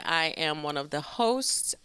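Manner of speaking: ordinary talk, nothing marked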